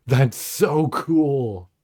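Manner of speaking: very slow, high awed gush. Real, not acted. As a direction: draw it out in wonder. Awed reaction.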